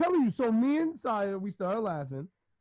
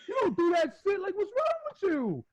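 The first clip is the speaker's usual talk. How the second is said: exasperated dad; 11 semitones higher than usual. Retold or imitated. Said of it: "And I'm like".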